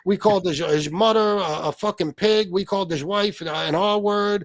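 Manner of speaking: whining impression of an aggrieved innocent; Pizza. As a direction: play a wronged, baffled complainer. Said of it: "I did that in Pizza's voice".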